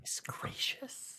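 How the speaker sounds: soft whispered mockery; whispered aside. Real, not acted. Player mocking the NPC's manners, whispered. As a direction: hiss it under your breath to the table.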